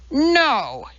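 a sharp high shouted no. A narrator's reading. Scientist refusing the insult, shouting. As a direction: cut her off with a shout. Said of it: "Elizabeth shouted".

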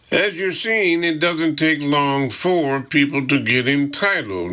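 high-pitched, ironic London secretary. A narrator's reading. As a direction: make the pointed remark with irony, voice pitched well up. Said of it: "Maggie says ironically".

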